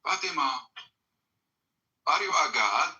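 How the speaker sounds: ordinary talk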